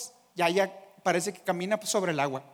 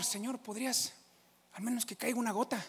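timid plea; 3 semitones higher, 9 dB quieter than usual. Retold or imitated. Noah pleading softly.

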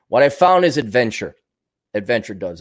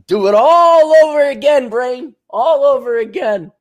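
mock exclaim; 8 semitones higher, 6 dB louder than usual. Pinky and the Brain quote.

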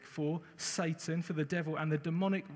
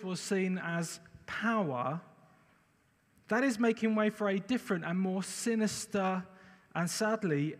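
preaching; 3 semitones higher than usual. Acted. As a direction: preach it with conviction. Preaches the opening point.

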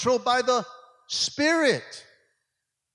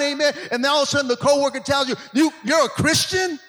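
loud, driving sermon delivery; preaching at full force; 3 semitones higher, 5 dB louder than usual. Acted. Pastor urging the church to crucify the flesh, fervent preaching. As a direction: preach it hard to the congregation.

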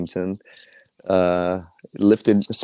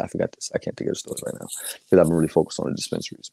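whispered aside; 4 semitones lower, 4 dB quieter, faster than usual. Real, not acted. Muttered aside.

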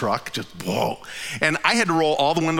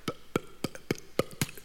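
breathy, voiceless p-p-p mimicking a loose wheel; imitated thumping; 13 dB quieter than usual. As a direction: wheel flapping under him, so he sputters the thump.

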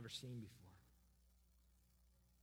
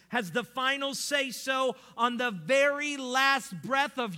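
preaching climax; 7 semitones higher, 14 dB louder than usual. Real, not acted. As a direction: build to a shouted pulpit climax.

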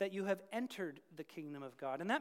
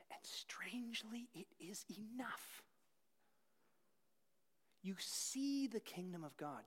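hushed sincerity; 9 dB quieter than usual. Real, not acted. Tender hush after whispered quote.

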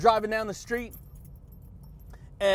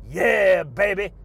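hype shout; 2 semitones lower, 8 dB louder, slower than usual. Real, not acted. Vlogger hollering after his rhyme to viewers.

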